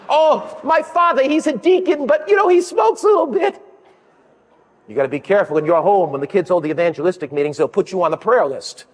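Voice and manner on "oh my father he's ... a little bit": put-on little child. Child telling the class about her father, high laughing voice. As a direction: play an innocent kid telling on dad.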